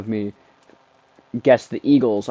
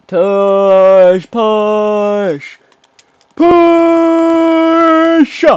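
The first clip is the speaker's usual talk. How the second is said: high, loud shout with stretched vowels; drawn-out battle yell; 10 semitones higher, 8 dB louder, much slower than usual. Real, not acted. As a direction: bellow the play, holding the last word very long. Yelling on the tush push play.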